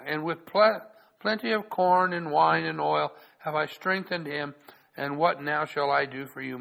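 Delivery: loud, measured Bible reading; solemn public reading of scripture. Acted. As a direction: read it out like the pulpit Bible.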